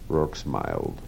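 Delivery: ordinary delivery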